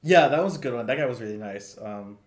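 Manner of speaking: ordinary delivery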